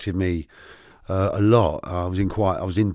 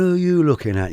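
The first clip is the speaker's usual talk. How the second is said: higher-pitched mock Liverpool accent; imitated Liverpool accent; 7 semitones higher than usual. Liverpool fan challenging a rival supporter, imitated Liverpool accent. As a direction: put on a Liverpool fan's accent. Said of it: "in that lovely accent".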